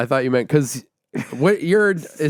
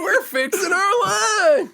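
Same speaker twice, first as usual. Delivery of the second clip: very high, slow Southern squeal; mock Southern accent; slower than usual. Host mimicking his co-host, in a squealed Southern accent. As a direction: imitate the twang way up high, stretching LUNCH.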